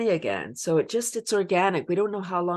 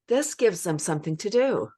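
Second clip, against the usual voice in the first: normal talking voice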